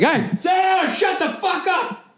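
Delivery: high mock-shouted family scolding; mock parent yelling. Comic imitating a shouting parent telling him to shut up, high and mock-angry. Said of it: "one of those families like".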